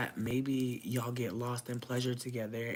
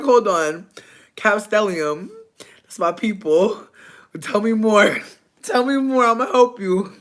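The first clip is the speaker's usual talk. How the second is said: excited; 8 semitones higher, 14 dB louder than usual. Retold or imitated. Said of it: "because I'm like".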